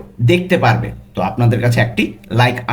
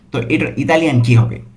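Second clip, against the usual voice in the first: ordinary delivery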